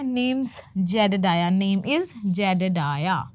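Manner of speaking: "Jedediah" spelled out very slowly, syllable by syllable; slow teaching read of "Jedediah"; careful slow pronunciation. Shown as a demonstration. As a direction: stretch out "Name's Jedediah" for learners. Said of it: "इसका pronunciation क्या है?".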